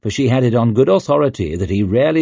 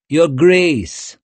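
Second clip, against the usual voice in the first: higher, theatrical courtier intoning; intoned greeting; 7 semitones higher, 4 dB louder than usual. A narrator's reading. Swarbrek to Savine with a bow, grandly intoned. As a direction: sweep it out grandly like a flatterer. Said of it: "he intoned".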